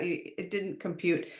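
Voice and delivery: ordinary delivery, nothing marked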